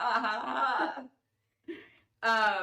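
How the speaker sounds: mock laugh. Co-host reacting to the four point oh quip, mock laughter. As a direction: laugh in separate, put-on syllables.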